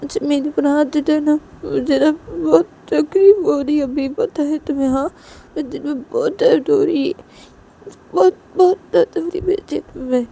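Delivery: high, loud, aching complaint; wounded loved one protesting loudly; hurt, pleading Shanaya. Acted.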